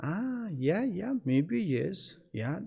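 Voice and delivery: slow, drawn-out maybe-agreement; clever, noncommittal agreement. Acted. Clever friend hearing gossip about another friend, noncommittal agreement. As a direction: agree without committing, stretching it out. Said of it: "Santakoi is very clever".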